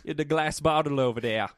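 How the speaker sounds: put-on the cab driver's excuse in a Chris D'Elia accent. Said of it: "Is that the Chris D'Elia accent?".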